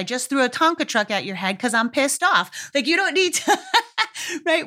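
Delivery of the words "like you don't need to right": laughing through the words, higher. Real, not acted. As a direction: let a laugh break into it. Laughs at her own rant.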